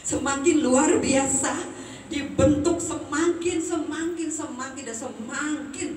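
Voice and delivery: high and loud, slowing on each semakin; rising sermon. Acted. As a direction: stretch out the promise, building fervor. Preaching building to climax.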